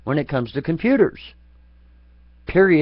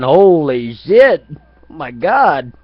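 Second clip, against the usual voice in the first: mock shocked yell; 9 dB louder, much slower than usual. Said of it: "Proctologist was looking up in that ass going".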